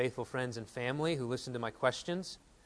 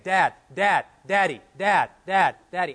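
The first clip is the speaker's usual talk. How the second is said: Dad called loud and higher, over and over; loud calling out; 6 semitones higher, 14 dB louder than usual. Shown as a demonstration. Preacher urging listeners to keep calling on God, loud child's calling. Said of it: "Keep saying".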